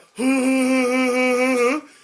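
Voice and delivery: high, loud, breathy mock laugh; imitated silly laugh. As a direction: do the kid's goofy laugh. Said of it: "And she does that".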